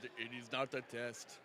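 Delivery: "It is not a test" in a low, slow Schwarzenegger accent, deep and measured; Arnold Schwarzenegger accent. Shown as a demonstration. Redoing the podcast name as Schwarzenegger, low, slow Austrian accent. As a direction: drop the voice and drag out the Schwarzenegger accent. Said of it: "like, accent".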